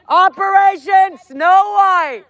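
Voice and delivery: high, loud slogan yell; projected protest callout; protest shout. Real, not acted. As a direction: holler it across the street.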